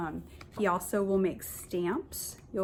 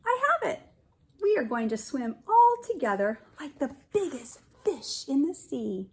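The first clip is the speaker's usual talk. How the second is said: much higher, louder burst of excitement; excited Swimmy; 6 semitones higher, 6 dB louder than usual. Acted. Teacher voicing Swimmy's sudden idea, much higher and louder. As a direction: shout out the big idea with joy.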